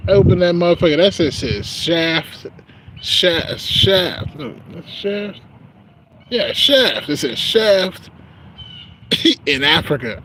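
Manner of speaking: loud, high, laughing disbelief; cracking up over the wrong package; rising giddy amazement; excited. Real, not acted.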